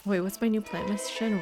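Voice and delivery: ordinary talk, nothing marked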